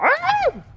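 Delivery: sudden very high squeal of delight. Real, not acted. Streamer squeals at a player's cat picture, very high squeal. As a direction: squeak like you just saw a kitten.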